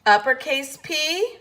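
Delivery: ordinary talk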